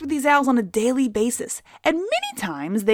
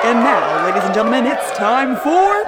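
game show host; 4 dB louder than usual. Acted. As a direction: boom it like a TV host.

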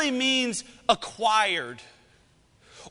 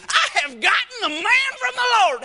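much higher, louder, hammed-up delight; exaggerated inflection; 11 semitones higher, 5 dB louder than usual. Shown as a demonstration. Preacher demoing another way to say it, hammed-up joy. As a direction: overplay the joy for the congregation. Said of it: "You can say that with whatever inflections you".

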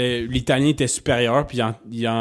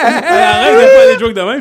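high, loud protest through laughter; helpless laughter; 10 dB louder than usual. Real, not acted. Begging friend to stop the repeated joke, real helpless laughing. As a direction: squeal it out while cracking up.

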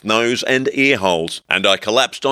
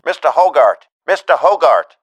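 high, louder squeaky yell; tiny yelling voice; 7 semitones higher, 6 dB louder than usual. A narrator's reading. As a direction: holler from a tiny bullhorn. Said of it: "yelling through a pinhead-sized bullhorn".

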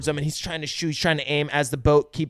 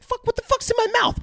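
much higher, cartoonish shark griping; a cartoon shark annoyed at its mouthful. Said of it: "He's like".

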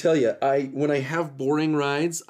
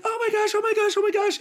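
high, frantic repeated cry; mock panicked scream; faster than usual. Passenger Jacob during near accident, frantic high squeals. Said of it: "he starts screaming".

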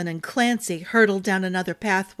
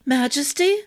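high, louder rising yelp on Majesty; startled yelp; 7 semitones higher, 4 dB louder than usual. A narrator's reading. Arden woken by the king, startled high yelp. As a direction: jolt awake and yelp the title. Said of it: "Arden yelped".

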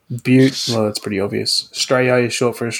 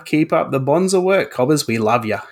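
hammed-up bogan Aussie accent; 3 semitones higher than usual. Signing off the fan review to the hosts, hammed-up bogan Aussie accent. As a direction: finish the fan review as a cheerful ocker Aussie. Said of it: "Or, or slightly more bogan".

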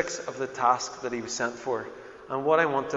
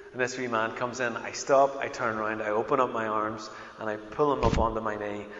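usual speaking voice

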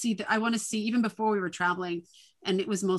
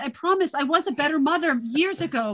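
higher, bit louder pleading mom; playful defensive mom; 7 semitones higher, 5 dB louder than usual. Retold or imitated. Mom defending herself to her kids, higher and laughing. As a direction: protest with a laugh, higher pitched. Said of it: "I'm like".

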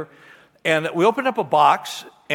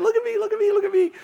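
put-on much higher, bouncing little-kid voice; excited toddler; faster than usual. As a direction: call out like a tiny boy running to mom. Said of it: "he's just all excited".

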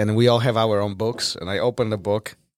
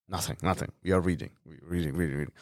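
hushed, quick denial to the teacher, sinking softer; 6 semitones lower, 11 dB quieter, faster than usual. Retold or imitated. Boy caught in class murmurs to the teacher, hushed. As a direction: whisper it like a guilty kid caught talking.

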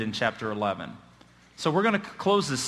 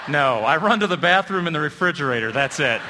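louder line through real laughter; laughing; 5 dB louder than usual. Real, not acted. Preacher joking to the congregation about his running, laughing. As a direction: deliver the punchline while still laughing. Isn't that funny?.

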